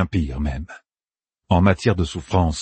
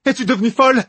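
high-pitched, appalled exclamation; shocked cry; 4 dB louder, faster than usual. A narrator's reading. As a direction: cry out in disbelief at the attacker. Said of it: "s'écria Rubinde". (cri effaré, très aigu)

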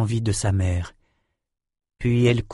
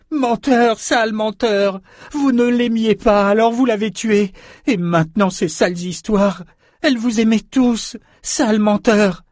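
put-on high-pitched raging young girl; furious accusation; 11 semitones higher, 3 dB louder than usual. A narrator's reading. Girl refuses to believe Richard about her mother, high rage. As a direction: spit out the insults in a high voice. Weeping, enraged girl calls him liar.

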